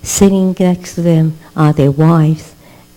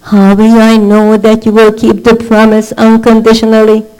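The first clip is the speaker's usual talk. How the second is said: higher, louder, impassioned preaching plea; pleading Abraham; 6 semitones higher, 6 dB louder than usual. Retold or imitated. Abraham pleading with God over the promise, impassioned. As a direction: beg God for certainty with fervour.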